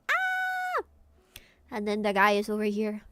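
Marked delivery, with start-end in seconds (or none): 0.1-0.8 high cartoon scream; panicked squeal. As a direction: shriek in mock panic. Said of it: "She's panicking".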